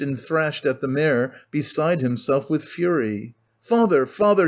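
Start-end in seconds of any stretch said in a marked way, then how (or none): 3.6-4.5 put-on higher, alarmed child's cry. A narrator's reading. Boy crying out to his father at the beating. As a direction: call out to father in distress. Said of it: "he cried".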